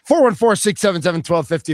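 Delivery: loud, punchy number read; radio host read. Acted. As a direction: announce the number on air. Reads the call-in number.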